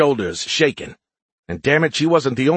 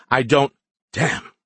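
usual speaking voice

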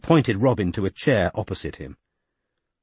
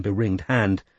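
plain reading voice

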